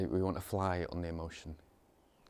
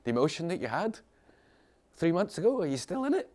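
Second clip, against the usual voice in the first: teasing question; 10 semitones higher, 8 dB louder than usual. Real, not acted.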